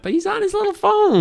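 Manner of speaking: very high squeaky sneer; mock baby talk. Mocking the guard.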